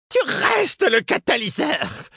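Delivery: extremely high, slow, piercing cry; shrill cry. A narrator's reading. Abandoned woman crying after the departing narrator, shrill and despairing. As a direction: cry it out in a desperate shriek. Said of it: "me cria-t-elle d'une voix suraiguë".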